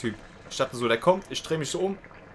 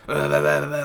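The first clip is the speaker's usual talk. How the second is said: louder babbled imitation of yelling; mock ranting; 5 dB louder than usual. Clerk suddenly ranting at the trainee, mock yelled babble. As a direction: mimic someone's wordless rant. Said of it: "auf einmal die schreit so rum".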